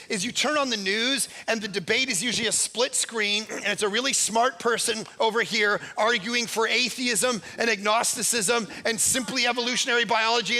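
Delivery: ordinary delivery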